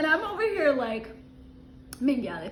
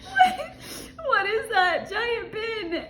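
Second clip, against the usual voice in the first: laughing quote; 6 semitones higher, slower than usual. Retold or imitated. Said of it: "she goes".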